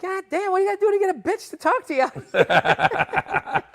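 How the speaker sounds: very high, loud comic holler; exasperated outburst. Retold or imitated. Man rebuffed by women on the street, exasperated yell. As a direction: cry out in mock exasperation.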